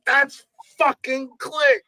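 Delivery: high, drawn-out insistence; insistent starstruck fan. Retold or imitated. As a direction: imitate a drunk fan who is sure. Fan insists against doubting friends.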